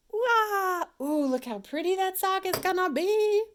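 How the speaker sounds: high, loud gushing voice; delighted squeal. Real, not acted. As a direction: gush over the pretty sock. Admiring the yarn.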